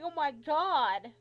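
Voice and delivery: loud, drawn-out disbelief; appalled exclamation. Retold or imitated. Patient aghast at the rude doctor, loud and appalled. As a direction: stretch it out, loudly aghast. Shocked reaction to yelling, retold.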